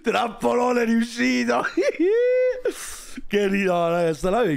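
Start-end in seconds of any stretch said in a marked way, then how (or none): 0.0-1.6 a slow, high, triumphant shout; gleeful shout. Real, not acted. Streamer gloating at the trap on his live, shouting. 1.7-3.2 a very high, shouted burst of laughter; shrieking laugh. Real, not acted. Streamer cracking up at the guest's blunder, shrieking. Trap sprung on live stream.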